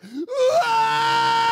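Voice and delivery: very high, very loud imitated shriek; primeval scream. Preacher imitating Jemima screaming again in the garden, very high loud shriek. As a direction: let out a wild panicked scream. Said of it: "she's like".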